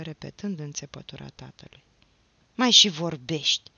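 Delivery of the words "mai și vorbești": loud, cross grumble; woman's exasperated grumble; grumbling. A narrator's reading. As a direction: grumble at him sharply and loudly. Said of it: "bombăni femeia".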